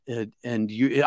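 ordinary talk

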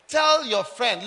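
ordinary delivery

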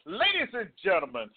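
drawn-out showman announcement; slow, booming circus announcer; ringmaster. Acted. Announcer introduces co-host segment.

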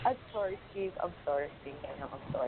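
soft, low, trailing apology; sheepish apology. Real, not acted. Sheepish apology after teasing co-host.

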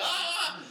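high short mock yelp of helplessness; a quick high burst of frustration; helpless yelp. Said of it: "you're just like".

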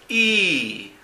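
"I" as a drawn-out ee, falling; long vowel demo. Shown as a demonstration. Teacher shows how long the "I" sound is, long vowel demo. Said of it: "¿Ven cómo es largo?".

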